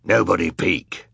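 villainous man giving a command; villain's order. A narrator's reading. Villainous judge forbidding the crowd to look, stern order. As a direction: order them in a villain's character voice. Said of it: "ordered the man with a beard".

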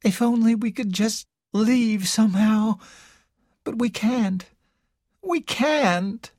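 a lightly voiced despairing sister; hopeless. A narrator's reading. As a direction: give up on each word, falling away. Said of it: "Carol said in a hopeless voice".

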